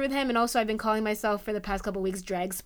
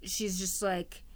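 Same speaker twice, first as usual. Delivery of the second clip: ordinary talk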